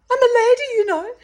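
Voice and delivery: very high, loud prissy protest; prim lady. Retold or imitated. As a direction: insist on your dignity in a fluster. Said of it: "I'd be like".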